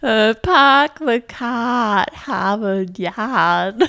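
slow, flattened Boston A's; park the car the Boston way; Boston accent. Pretend. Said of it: "try the full phrase".

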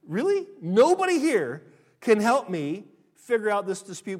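acted, appalled exclamation; incredulous outburst. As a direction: protest loudly, voice leaping up. Acted disbelief at church's refusal.

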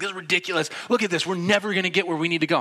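high-pitched exasperated griping; mock frustration, quickening toward the end; frustrated. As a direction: imitate a fed-up friend in traffic. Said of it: "He's so frustrated".

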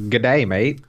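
mock Australian greeting; a cheery Aussie hello. Cohost greeting in mock Australian accent. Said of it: "Where'd that come from?".

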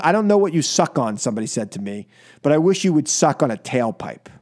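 ordinary delivery

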